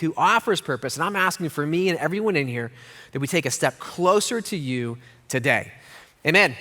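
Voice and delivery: hushed pastoral prayer. Acted. Pastor prays for congregation.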